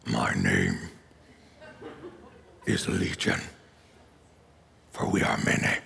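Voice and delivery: very low, distorted demon growl; demon impression. Shown as a demonstration. Preacher growling the demon's line at his congregation. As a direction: drop into a deep otherworldly demon voice. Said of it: "That's my best demon impression".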